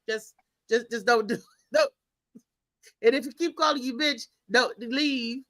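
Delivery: ordinary talk, nothing marked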